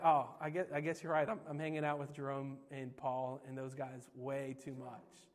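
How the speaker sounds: low, trailing-off mumble; sheepish admission. Retold or imitated. Backs down after being challenged.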